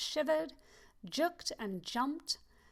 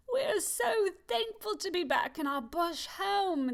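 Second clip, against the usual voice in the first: calling out; 7 semitones higher than usual. Acted.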